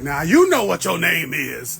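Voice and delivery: loud, slow elder scolding; old elder voice. Acted. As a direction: play an older relative telling someone off. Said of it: "over fifty, I'm just gonna start talking like this".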